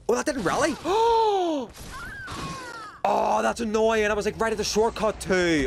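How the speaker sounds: high, loud dismayed gasp; dismayed surprise. Real, not acted. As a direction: groan in disbelief at the loss. Dies just before the shortcut.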